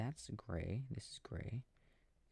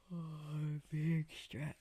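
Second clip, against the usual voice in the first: higher, slow sing-song coo; 6 semitones higher, 4 dB louder, slower than usual. Real, not acted. Cooing to the stretching baby.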